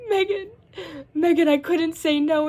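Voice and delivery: hammed-up despair, stretching every word; panicked wail. Running to Chloe, wailing that she couldn't say no. Same plea to Megan.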